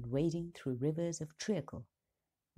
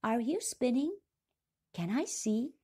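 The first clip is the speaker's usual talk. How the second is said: put-on high, loud little child voice; 8 semitones higher, 4 dB louder than usual. A narrator's reading. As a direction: call out like a sleeping child. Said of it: "said loudly and clearly".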